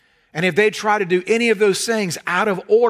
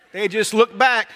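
ordinary delivery

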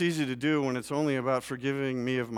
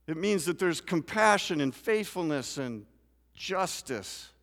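higher, louder preaching; full-force preaching; 7 semitones higher, 6 dB louder than usual. Acted. Pastor telling his church salvation means more, loud preaching. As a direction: press the point hard on justice.

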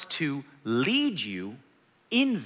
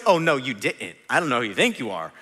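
mock-sassy "oh no you didn't", lower and quicker at the end; sassy comeback; 5 semitones lower than usual. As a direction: play a defensive snap-back at a loved one, half in jest. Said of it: "And you just went".

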